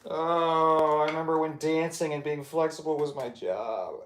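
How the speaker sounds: high, strained, sustained moan of words; groaning through a stretch. Real, not acted. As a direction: moan it out while stretched to the limit.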